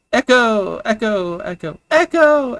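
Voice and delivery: high, loud call down a well; playful shouting into a well; playful echo calling. Acted. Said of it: "he's playing more than anything".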